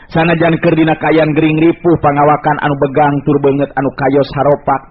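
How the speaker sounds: ordinary talk, nothing marked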